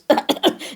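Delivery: short spluttering cough; throat-clearing cough. A narrator's reading. The spider landed by Duncan Mouse, clearing his throat. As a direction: clear the throat before speaking. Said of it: "familiar throat-clearing splutter".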